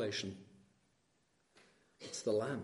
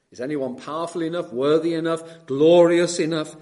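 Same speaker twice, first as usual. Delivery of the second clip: rising louder and higher with each phrase; building cry; 4 semitones higher, 10 dB louder than usual. Acted. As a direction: build to a ringing call.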